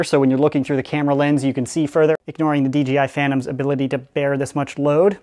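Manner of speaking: usual speaking voice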